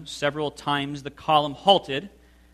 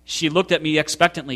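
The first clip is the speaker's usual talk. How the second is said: ordinary delivery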